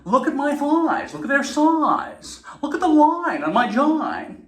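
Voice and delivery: high slow exaggerated aerobics diva; Jane Fonda impression. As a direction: gush over your body like a workout video host. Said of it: "she's like".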